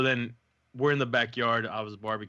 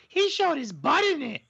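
put-on much higher child's voice; little kid; 4 dB louder than usual. Little daughter tattles to the host about the cartoon, imitated high kid voice. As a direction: mimic a small girl tattling. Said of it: "the little one goes".

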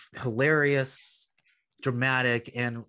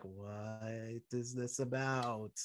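confused mutter; 10 dB quieter, much slower than usual. Retold or imitated. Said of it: "So we're like".

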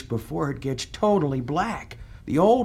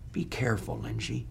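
ominous low warning; 6 semitones lower, 8 dB quieter than usual. Acted.

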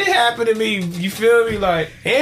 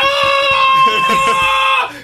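long, loud, very high mock wail; imitated baby scream; 5 dB louder than usual. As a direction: shriek like a frightened baby.

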